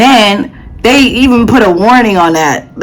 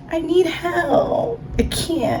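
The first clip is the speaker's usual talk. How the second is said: higher, soft helpless whine; mock helpless; 10 semitones higher, 9 dB quieter, much slower than usual. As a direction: whimper like you can't cope. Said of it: "She's like".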